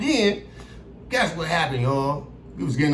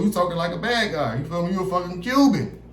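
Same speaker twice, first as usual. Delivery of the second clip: ordinary delivery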